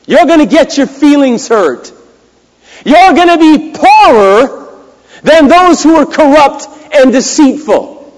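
high, loud, emphatic sermon delivery; full-force preaching. Real, not acted. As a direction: drive it home to the congregation.

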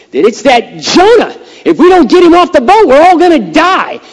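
high, loud alarmed shout; hammed-up frantic yell; panicked sailors. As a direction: shout in mock panic over the storm.

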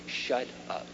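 quiet blunt whisper; whispered shut up. Shown as a demonstration. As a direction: drop to a hush on "Shut up". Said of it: "sermon advice, whispered".

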